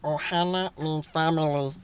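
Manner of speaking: soft alien creature voice; Stitch. Acted. Friend trying out his new impression, Stitch creature voice. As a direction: put on a small cartoon creature's voice. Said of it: "get in the mindset of Stitch".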